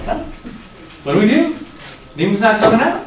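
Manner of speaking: high, puzzled disciples giving up; bewildered, what now; confused. Retold or imitated. Disciples' confused reaction voiced.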